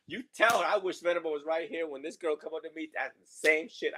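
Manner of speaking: usual speaking voice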